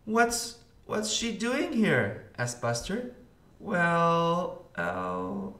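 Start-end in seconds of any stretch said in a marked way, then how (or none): 0.1-2.3 high-pitched, startled question; surprised question. A narrator's reading. Buster spotting D W at the club, surprised. 3.6-5.5 high, hesitant fumbling for words; stammering. A narrator's reading. Arthur explaining his sister to friends, stammering. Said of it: "Arthur stammered".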